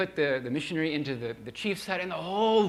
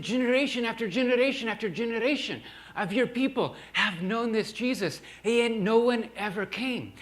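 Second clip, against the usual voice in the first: mournful lament; 6 semitones higher than usual. Retold or imitated. Grandpa laments.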